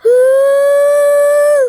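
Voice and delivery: high, loud, long ghostly wail; ghost woo. Pretend. Prankster hiding as a ghost wails at the kid, high and loud.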